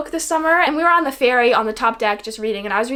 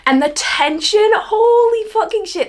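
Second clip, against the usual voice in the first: higher, drawn out and thrilled; excited outburst; 8 semitones higher, 3 dB louder, slower than usual. Real, not acted. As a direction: gush about it, slow and carried away. Gushing over the book's tension.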